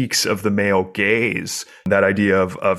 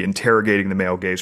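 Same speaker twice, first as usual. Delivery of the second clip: ordinary talk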